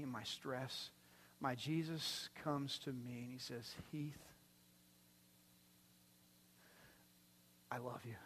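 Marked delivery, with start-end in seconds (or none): 3.9-8.1 soft, hushed and intimate; tender love. Acted. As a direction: say his name like a gentle embrace. Jesus speaking tenderly to the preacher.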